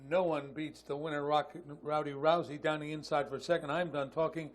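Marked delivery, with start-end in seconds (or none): none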